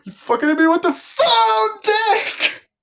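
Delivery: very high, loud squealing outrage; outraged shriek. Retold or imitated. Stu shrieking at Sidney after she hits him, outraged. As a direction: shriek it like you can't believe she did that. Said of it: "he says the best line in the film".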